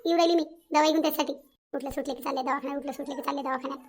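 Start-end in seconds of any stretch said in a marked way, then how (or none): none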